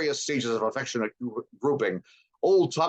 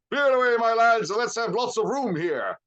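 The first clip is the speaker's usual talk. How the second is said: high, loud merry order; a jovial master clearing the floor; jolly Fezziwig; 12 semitones higher, 4 dB louder than usual. A narrator's reading. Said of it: "old Fezziwig".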